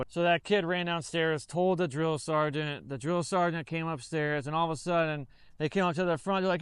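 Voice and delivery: ordinary talk, nothing marked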